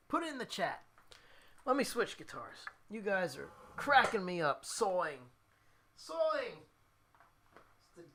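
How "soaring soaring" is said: playful "sawing" for soaring, New Jersey style; New Jersey accent. Shown as a demonstration. Cracked up by chat's teasing, New Jersey accent. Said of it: "it's the New Jersey accent".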